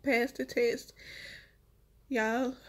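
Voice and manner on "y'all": usual speaking voice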